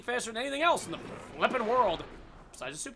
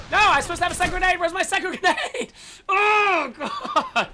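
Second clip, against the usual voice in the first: loud, high, fast protest breaking into a wail; high-pitched rant ending in a long cry; frustrated outburst; 8 semitones higher, 7 dB louder than usual. Real, not acted. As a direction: yell in real exasperation. Missing second grenade in game.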